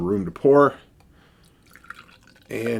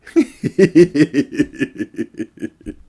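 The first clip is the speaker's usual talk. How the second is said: a louder laugh trailing down; laughing again; 6 semitones higher, 8 dB louder than usual. Real, not acted. Reviewer reading the "eh?" point aloud again, laughing. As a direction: chuckle it out and let it fall.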